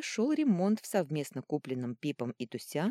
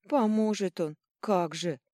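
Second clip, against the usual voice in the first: higher, louder sarcastic retort; sceptical scoff; 3 semitones higher, 5 dB louder than usual. A narrator's reading. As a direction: scoff at the promise. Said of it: "хмыкнула подруга". (скептически хмыкая)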